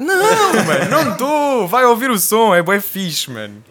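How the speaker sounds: high, loud laughing protest; laughing denial. Retold or imitated. Retold reply to followers.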